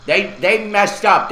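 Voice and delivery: ordinary talk, nothing marked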